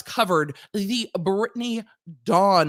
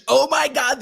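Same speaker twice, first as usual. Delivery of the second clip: louder exasperated cry; burst of rage; 6 semitones higher, 6 dB louder than usual. Real, not acted. Venting about Ticketmaster fees, exasperated louder cry. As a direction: let the rage out. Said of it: "the internal rage".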